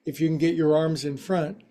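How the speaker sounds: ordinary talk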